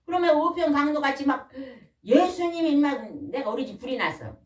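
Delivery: ordinary delivery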